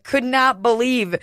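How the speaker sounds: usual speaking voice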